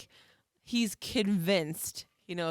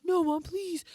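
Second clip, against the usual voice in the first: put-on pleading kid; 12 semitones higher, slower than usual. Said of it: "Like, almost crying".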